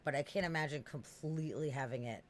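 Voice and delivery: usual speaking voice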